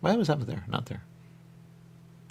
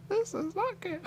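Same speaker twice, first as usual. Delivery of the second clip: high cartoon voice. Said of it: "They go".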